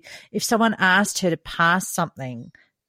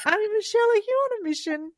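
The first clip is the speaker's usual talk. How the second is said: put-on high child's voice; 13 semitones higher, much faster than usual. Child catching aunt's mission.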